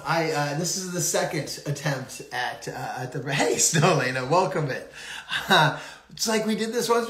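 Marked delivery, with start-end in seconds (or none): none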